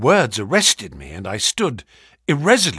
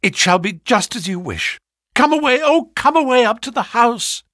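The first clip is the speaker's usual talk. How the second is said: high, eager, loud urging; relieved but pressing wife's voice; urgent wife; 10 semitones higher, 5 dB louder than usual. Acted. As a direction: hurry him away, pitch climbing. Relieved wife urges husband away.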